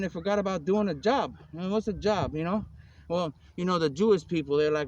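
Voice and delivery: ordinary talk, nothing marked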